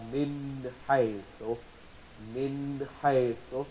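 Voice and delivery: slow Min haythu, the n of Min clear before the ha; clear izhar. Shown as a demonstration. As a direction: draw out Min haythu with a distinct n. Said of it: "we do pronounce noon with izhar".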